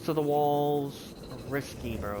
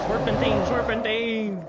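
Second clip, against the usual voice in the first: loud panicked repeated call; shouted escape cry; frantic shout; 6 semitones higher, 9 dB louder than usual. Real, not acted. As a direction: yell it high while running for your life. Fleeing a creature, shouting.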